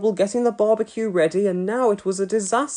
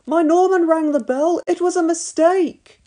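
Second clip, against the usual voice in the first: pleading mum; 12 semitones higher, 4 dB louder than usual. A narrator's reading.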